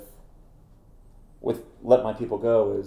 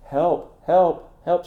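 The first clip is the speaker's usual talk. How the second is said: higher, louder cries of help; retold scream for help; 8 semitones higher, 8 dB louder, much slower than usual. Woman whose purse was stolen calls for help, retold yell. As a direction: imitate a robbed woman yelling help. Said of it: "this woman's screaming".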